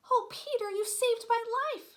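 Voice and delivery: high, loud breathless relief; Wendy's rescued, overjoyed exclamation; grateful cry. Acted. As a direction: cry out thanks to your rescuer. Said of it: "she cried".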